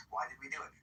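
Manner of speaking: usual speaking voice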